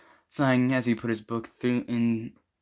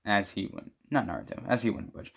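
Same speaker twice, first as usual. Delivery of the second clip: ordinary delivery, nothing marked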